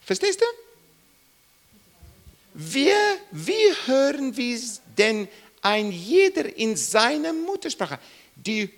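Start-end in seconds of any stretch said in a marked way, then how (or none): none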